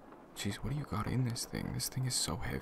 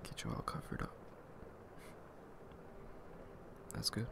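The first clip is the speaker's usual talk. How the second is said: tender whisper; 8 semitones lower, 8 dB quieter than usual. Acted. Tucking listener into blanket.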